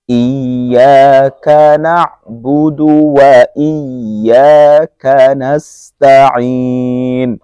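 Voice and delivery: slow, melodic tajweed recitation; Quran recitation. Shown as a demonstration. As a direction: recite slowly with drawn vowels. Teacher recites verse.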